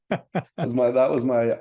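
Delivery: laughing through the words. Real, not acted. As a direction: laugh it off, embarrassed. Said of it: "Did she just swallow a burp?".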